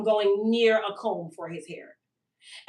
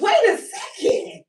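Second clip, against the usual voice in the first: much higher, louder and slow, near a shout; stunned realization; 6 dB louder, slower than usual. Retold or imitated. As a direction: cry out in amazed disbelief. Realizing the mask works.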